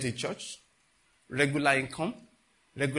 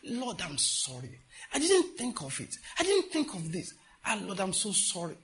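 retold tearful apology; 5 semitones higher than usual. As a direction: act out crying and begging the Lord's forgiveness. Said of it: "I started crying".

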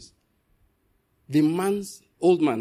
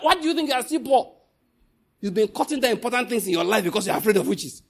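fiery preaching; 5 semitones higher than usual. Real, not acted. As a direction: scold them with full fire. Preaching at full force.

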